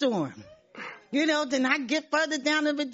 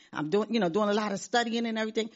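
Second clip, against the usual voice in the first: ordinary talk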